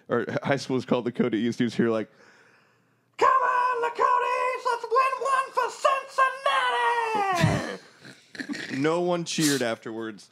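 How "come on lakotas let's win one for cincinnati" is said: yelling. Retold or imitated. Dad yelling for the band into a silent stadium, full-voiced holler. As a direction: belt out a full-voiced cheer, far higher than talk. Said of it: "who the fuck yelled?".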